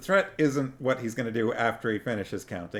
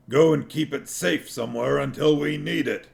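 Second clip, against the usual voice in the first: dwarf voice; 5 semitones higher, 4 dB louder than usual. A narrator's reading. Dwarf sneering.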